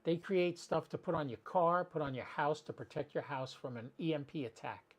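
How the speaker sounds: ordinary talk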